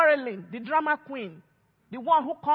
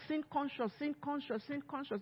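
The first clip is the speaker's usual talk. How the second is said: each repeat softer, trailing off; softer and softer repetition; fading away; 14 dB quieter than usual. Real, not acted. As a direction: let the phrase die away into a murmur.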